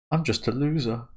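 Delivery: a hint of a mopey teen; mock self-pity. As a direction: sulk it out. Reading a self-pitying character's line.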